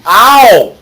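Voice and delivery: loud, high howl of pain; pained yelp. Acted. Captive hit for complaining about readers, pained yelp.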